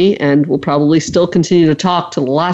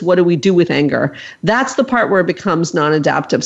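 normal talking voice

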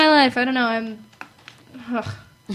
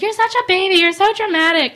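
higher mock nagging mom; 7 semitones higher, 3 dB louder than usual. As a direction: sneer like an unimpressed mother. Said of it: "And my mom's like".

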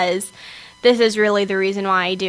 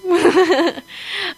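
short giggle, rising; bright laugh; 8 semitones higher than usual. Real, not acted. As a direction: laugh it off lightly. Laughs at her own joke.

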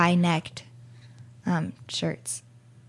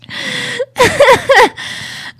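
high loud burst of laughter; 10 dB louder than usual. Real, not acted. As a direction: break into helpless laughter.

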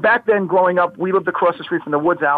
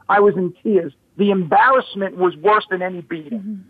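ordinary talk

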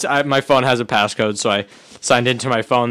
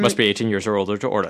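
fast fine-print disclaimer; 5 semitones lower, 6 dB quieter, faster than usual. Shown as a demonstration.

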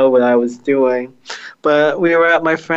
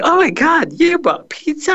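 put-on excited girl voice.

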